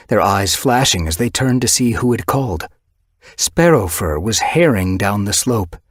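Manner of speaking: plain reading voice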